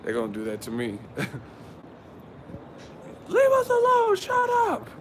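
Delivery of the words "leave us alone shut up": very high, strained put-on voice; mock neighbor yell. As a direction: imitate a cranky neighbor telling him off. Neighbors yelling in movie scene.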